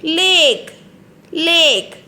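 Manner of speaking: Lake said with a long ay. Shown as a demonstration. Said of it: "What is this?".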